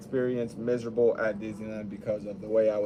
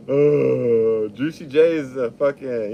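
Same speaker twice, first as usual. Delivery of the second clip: normal talking voice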